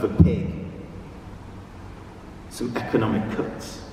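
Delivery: measured poem recital with long pauses; poetry reading. Acted. Reads own poem aloud.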